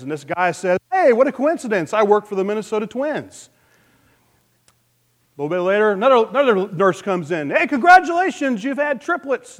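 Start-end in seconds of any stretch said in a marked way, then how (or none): 0.9-3.5 excited new father. Acted. Joke: proud father replies. 7.5-9.6 much higher, bright nurse voice; nurse announcing. Acted. Nurse tells a waiting father about his triplets, in a high cheery voice.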